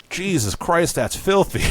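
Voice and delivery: high-pitched mock horror; disgusted outburst. Maid seeing what Will pulls out, disgusted. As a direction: recoil with a high, appalled cry.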